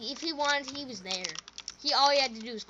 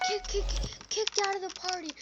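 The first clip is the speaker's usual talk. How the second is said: high stammering on kick kick kick; stuttering; 8 semitones higher than usual. Retold or imitated. Drunk guy scolding the kids, high stammering. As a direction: trip over the words like a drunk. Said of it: "My man was stuttering".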